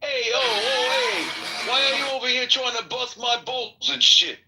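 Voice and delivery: a touch of a brash New York comic; lightly voiced New York tough guy; mild brash Brooklyn-style complaint; Andrew Dice Clay. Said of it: "Andrew Dice Clay for me was like".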